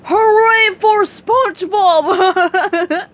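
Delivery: high, loud, very slow cheer; shout. Acted. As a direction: cheer it from far away. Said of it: "someone shouted".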